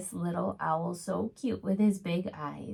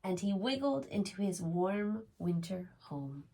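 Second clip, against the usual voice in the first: gentle storybook reading voice; reading to children; 3 semitones higher than usual. A narrator's reading. Reads a picture book aloud.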